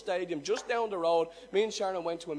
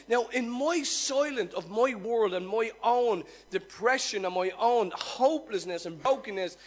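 ordinary delivery

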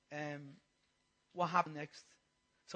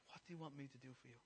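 very soft, low, quick question; gentle question; 7 semitones lower, 20 dB quieter, much faster than usual. Retold or imitated. Jesus asking the man before him, hushed question. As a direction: drop right down and ask gently.

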